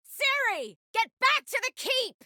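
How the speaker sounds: high, urgent shouted order; urgent call. Acted. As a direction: call her back from a distance, high-pitched. Urgent order called to Ciri.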